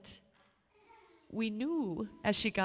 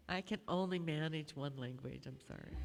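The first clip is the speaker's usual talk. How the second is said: ordinary talk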